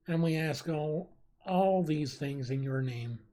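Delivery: solemn, hushed prayer voice. Acted. Leading the stream in prayer for the victims, solemn and hushed.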